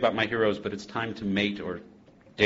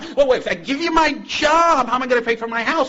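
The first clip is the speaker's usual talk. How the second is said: put-on flustered drunk; 7 dB louder than usual. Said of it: "the drunk trying to back out of the deal goes".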